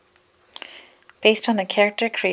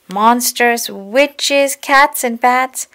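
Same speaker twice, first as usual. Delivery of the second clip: higher, louder, each item relished; excited list; 5 semitones higher, 7 dB louder, much slower than usual. A narrator's reading. Reader listing the window paintings for children, excited. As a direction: build through the list with gusto.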